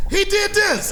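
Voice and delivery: put-on high, awed kid voice; nudging a friend in wonder; amazed whisper-shout. Kids elbowing each other over his art, high awed. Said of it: "They would hit each other like this".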